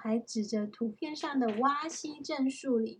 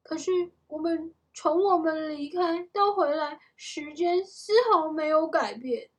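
put-on raised, louder voice of a baffled boy; puzzled protest; 5 semitones higher, 6 dB louder than usual. A narrator's reading. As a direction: object in disbelief. Jack objects, puzzled, in story.

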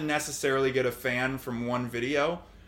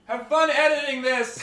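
raised, high-pitched yell; yelling from back; 10 semitones higher, 5 dB louder than usual. Real, not acted. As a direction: yell it across the room. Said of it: "I'm gonna yell from back here".

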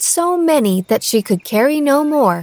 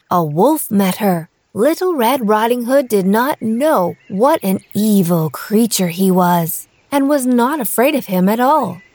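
usual speaking voice

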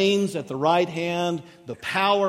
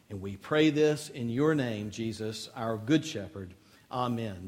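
closing prayer before the sermon; 3 semitones lower than usual. Acted.

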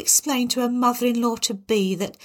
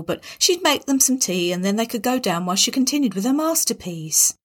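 ordinary delivery, nothing marked

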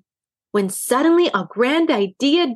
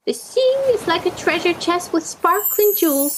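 high, put-on aunt voice; imitating her aunt; 7 semitones higher than usual. A narrator's reading. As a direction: mimic an aunt in a high affected voice. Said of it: "imitating her aunt's voice and words".

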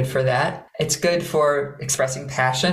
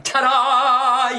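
high, drawn-out triumphant ta-da; big fiery fanfare; 8 semitones higher, 4 dB louder than usual. Shown as a demonstration. Teacher imitating people showing off, big and fiery. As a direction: belt it out like a show-off. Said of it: "people are going like".

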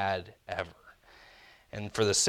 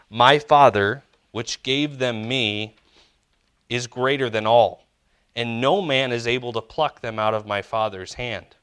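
forceful scripture reading; 7 dB louder than usual. Acted. Pastor booming a verse out to the church, forceful proclamation. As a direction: proclaim the verse loud and strong, slowing on the middle.

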